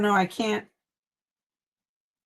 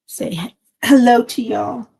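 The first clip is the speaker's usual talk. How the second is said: cooing to the pet; 6 dB louder than usual. Real, not acted. Streamer coaxing her pet to say hello, louder sing-song. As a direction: coax the pet to greet the viewers.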